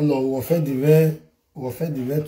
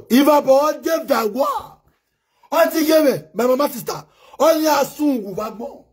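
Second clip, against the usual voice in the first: raised voice; 12 semitones higher, 4 dB louder than usual. Real, not acted. Heated outburst about the aunt.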